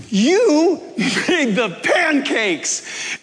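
slow, high, laughing delight; thrilled slow accusation of joy. Retold or imitated. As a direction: savour each word with glee. Finding the cook, delighted.

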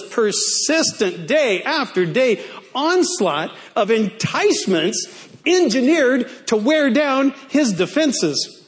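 ordinary delivery

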